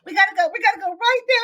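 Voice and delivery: high, rapid urgent excuse; frantic hurry. Retold or imitated. Mom fleeing with a screaming boy, frantic. Acts hurried escape from the house.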